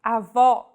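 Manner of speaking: "Avó" with the stress on an open final "ó"; open final vowel. Shown as a demonstration. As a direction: end "Avó" on a wide open "ó". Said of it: "This word means grandmother".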